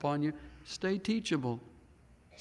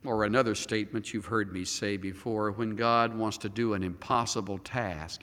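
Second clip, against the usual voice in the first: usual speaking voice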